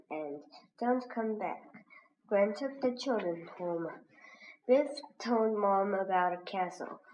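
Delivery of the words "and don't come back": usual speaking voice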